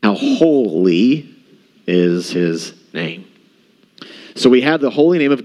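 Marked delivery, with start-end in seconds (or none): 0.0-3.2 slow reverent awe. Real, not acted. Reverent climax of sermon point.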